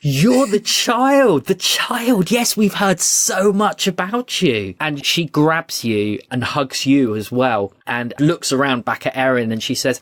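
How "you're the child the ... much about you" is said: high, drawn-out gushing; delighted queen. Acted. Queen meeting the child she has heard about, overjoyed. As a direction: coo with a huge smile in the voice. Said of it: "breaks into a huge smile and she says".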